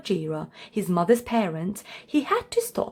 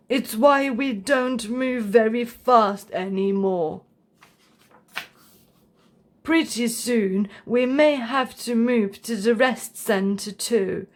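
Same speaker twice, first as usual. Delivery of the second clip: put-on old grandpa. A narrator's reading. Grandpa voiced.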